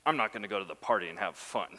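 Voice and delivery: fast, low whiny sulk; mock the pouting brother refusing; sulky mock brother. Preacher mocks older brother refusing the party, sulky whine. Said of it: "he's like".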